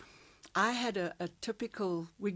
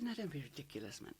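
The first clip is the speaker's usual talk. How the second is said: retold dismissive aside; 3 semitones lower, 13 dB quieter, faster than usual. Retelling her dismissal of the dare.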